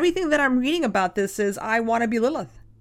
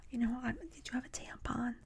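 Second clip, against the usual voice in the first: imitating a secretive whisper; a girl whispering for a tampon; 2 semitones higher, 16 dB quieter than usual. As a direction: hush it like a shameful secret. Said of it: "Like, don't whisper it".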